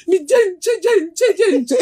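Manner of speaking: put-on high, giggling toddler voice; child's lisping words. Mother repeating her child's babble, high and laughing. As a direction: lisp it like a three-year-old.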